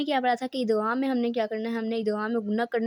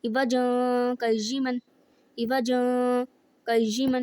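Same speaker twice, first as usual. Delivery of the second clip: short stop between عوجا and قیما; sakta pause; much slower than usual. Shown as a demonstration. Teacher demonstrates the sakta between the two words. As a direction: stop the voice after عوجا with no breath, then قیما rising. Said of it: "آواز رک جائے، سانس جاری رہے".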